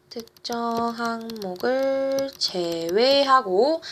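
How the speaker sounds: drawn-out syllables, writing along; slow, evenly spaced dictation. Real, not acted. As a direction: stretch each word as if writing it down. Repeats slowly while writing it out.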